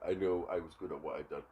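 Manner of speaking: ordinary talk, nothing marked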